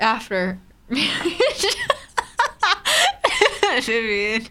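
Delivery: breaking up into high laughter mid-word; laughing. Real, not acted. Teasing co-host about a quick pregnancy, laughing. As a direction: say it through a fit of giggles.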